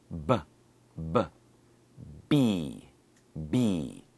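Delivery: B with lips together and a little explosion; B sound. Shown as a demonstration. Teacher showing learners how lips make B. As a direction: close the lips and burst the B. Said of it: "And it's like an explosion".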